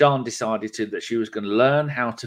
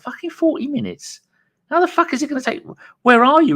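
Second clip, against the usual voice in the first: much higher, disbelieving, rising to loud; high shocked yelp; incredulous outburst; 5 dB louder than usual. Retold or imitated. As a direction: squawk in disbelief. Incredulous inner reaction to buyer.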